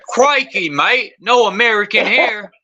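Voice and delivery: hammed-up, high-pitched mock Australian accent. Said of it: "You have to teach me the accent".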